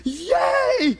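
high, loud cheer of victory; reenacted ecstatic yell; jubilant shout. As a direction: whoop it out in acted triumph. Reenacting his pumped celebration.

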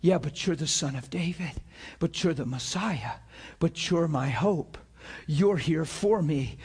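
quiet heartfelt plea. Acted. The blind man's heart to Jesus, a hushed low plea. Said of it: "but his heart says".

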